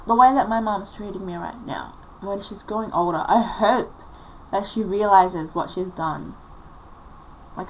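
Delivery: ordinary talk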